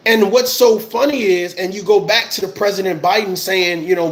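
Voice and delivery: ordinary delivery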